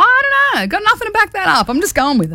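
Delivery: high, jokey 'no idea'; playful high shrug. Real, not acted. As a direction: toss it off high and breezy. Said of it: "playful shrug".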